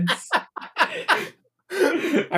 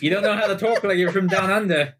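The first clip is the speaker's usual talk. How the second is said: higher, mock Australian twang; Australian accent; 6 semitones higher than usual. Player quizzes just-woken companion, mock Australian accent. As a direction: challenge them in a put-on Australian accent. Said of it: "Do you speak Australian?".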